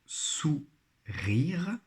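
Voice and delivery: "sourire" split into sou, pause, rire; syllable by syllable. Shown as a demonstration. Teacher helping pupils find the syllable, syllable by syllable. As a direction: sound out "sourire" in two beats. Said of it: "dans leur première syllabe".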